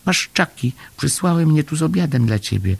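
very high little creature voice; small Chomek. A narrator's reading. Little Chomek answering Paszczak at the gate, small high voice.

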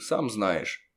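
plain reading voice

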